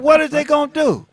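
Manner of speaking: high-pitched retold challenge; defiant retort. Man retelling how he answered the warnings, defiant.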